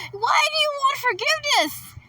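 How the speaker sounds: very high-pitched mock-crying yell; mock wail. Player mocking the character's plea for forgiveness, mock wail.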